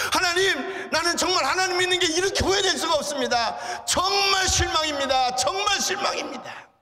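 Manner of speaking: high, loud disappointed cry to God; bitter outcry. Retold or imitated. Young man crying out his disappointment to God. Boy's resentment voiced at God.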